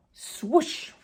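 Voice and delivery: high, airy swoosh like something darting off; whooshing swoosh. A narrator's reading. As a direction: make the swoosh of a fast escape. Clover whooshes away.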